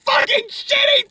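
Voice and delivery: high, slow furious shout; exasperated shout. Real, not acted. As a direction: yell it out in disgust. Exasperated outburst about movie.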